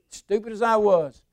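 normal talking voice

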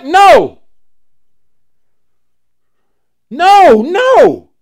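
loud, high pulpit denial; shouted no from the pulpit; emphatic preacher's no. Real, not acted. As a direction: hammer home the no. Preacher answering his own question.

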